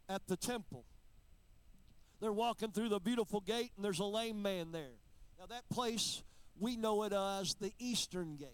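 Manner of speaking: steady preacher's retelling; lightly preached Bible story. Acted. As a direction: tell the Acts story from the pulpit. Preaching, telling Acts story.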